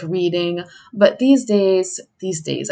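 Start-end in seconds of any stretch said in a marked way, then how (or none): 2.2-2.7 a lightly voiced old-timer sigh; old-sounding. Shown as a demonstration. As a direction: lower and softer, like an old person reminiscing. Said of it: "I sound old".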